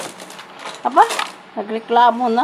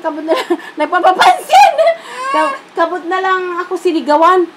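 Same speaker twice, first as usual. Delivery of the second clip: laughing; 6 semitones higher, 5 dB louder than usual. Real, not acted. Laughing while retelling being yelled at.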